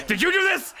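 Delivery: high shrill accusing cry; frantic high accusation at passersby; accusing Rahi. Acted. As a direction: demand an answer, pitched high. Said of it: "she goes".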